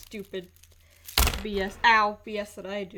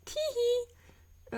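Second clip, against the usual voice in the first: tee-hee giggle; 13 semitones higher than usual. Real, not acted. Giggly sign-off after boast.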